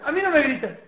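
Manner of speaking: high, loud mock retort of a quarrelling partner; hammed-up shouted protest; mock yelling. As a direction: snap back loudly, acting out a spat. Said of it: "empieza a gritar".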